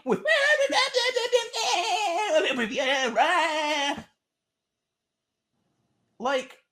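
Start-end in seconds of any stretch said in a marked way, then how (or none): 0.2-4.0 big laugh. Real, not acted. Laughing at own imitation.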